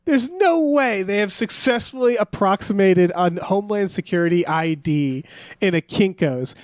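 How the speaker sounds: ordinary delivery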